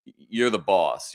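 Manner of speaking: normal talking voice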